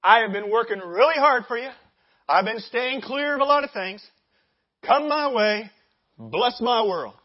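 loud, entitled demanding; barging demand. Acted. Entitled believer barging in on God, loud demand. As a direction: lay claim loudly, as if owed. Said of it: "You come barging in the doors".

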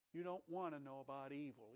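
gentle warning. Acted. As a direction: say \"You don't want to know about evil\" quietly and low. God gently warning Eve.

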